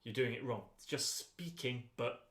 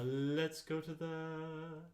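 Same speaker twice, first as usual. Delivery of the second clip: higher, slow intoned lead-in; 3 semitones higher, much slower than usual. Shown as a demonstration. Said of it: "Use more breath".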